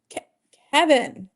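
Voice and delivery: calling out. Real, not acted. Calling the cat.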